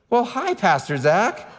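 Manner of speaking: high, slow sing-song greeting with a laugh; cheerful ticket taker. Retold or imitated. Ticket taker recognizes the pastor after the brawl, cheerful high greeting. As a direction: greet him brightly, oblivious to the scene. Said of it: "the ticket taker says".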